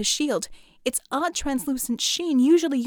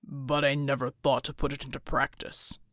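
deep big-man voice; 11 semitones lower, 4 dB quieter than usual. A narrator's reading. Bull's voice.

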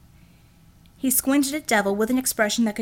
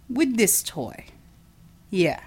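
fiendish grin; slower than usual. Acted. Matai grinning fiendishly.

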